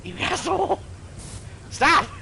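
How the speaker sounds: high-pitched mock outrage; high yelp of playful indignation; playful protest. Real, not acted. Playful protest at friend in game.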